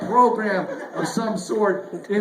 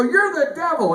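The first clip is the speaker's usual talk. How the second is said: higher, louder mock accusation; 7 semitones higher, 4 dB louder than usual. Comedian accusing the PayPal bot, louder and higher. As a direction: throw the insult at the bot. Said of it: "So I go".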